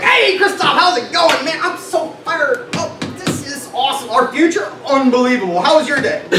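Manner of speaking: high, bright, fired-up mock greeting; hammed-up overjoyed parent coming home; mock cheerful mom. Mom coming home from a shift, impossibly cheerful. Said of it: "walk in the living room and was like".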